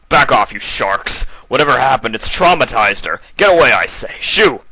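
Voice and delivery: loud, high shout driving them away; protective shout. Acted. Uncle shouting at the scientists to protect his niece, loud and high. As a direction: roar at the scientists to back off.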